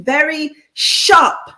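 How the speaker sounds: "very sharp" punched with a pause before "sharp"; emphasized. Real, not acted. Co-host reading out a viewer's compliment, emphasized. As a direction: lean hard on "sharp" in "very sharp". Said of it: "To emphasize it".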